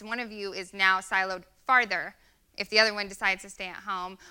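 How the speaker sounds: usual speaking voice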